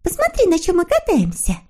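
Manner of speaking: put-on very high, sweet girlish character voice; eager little girl figure. A narrator's reading. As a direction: agree gladly in a high little voice. Snork Maiden voiced in story. (тоненький девичий голосок)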